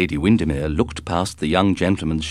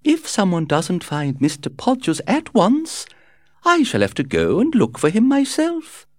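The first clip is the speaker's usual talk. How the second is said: high, chirpy, breezy society lady; gushing society hostess; 12 semitones higher, 4 dB louder than usual. A narrator's reading. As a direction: flutter through it like a gossiping hostess. Lady Windermere speaks.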